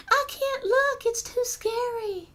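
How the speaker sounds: very high little cat voice; playfully frightened, high-pitched character voice; scared cat. Acted. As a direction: squeal it high in mock fright. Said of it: "The cat can't look".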